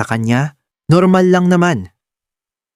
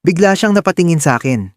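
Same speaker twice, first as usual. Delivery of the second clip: ordinary delivery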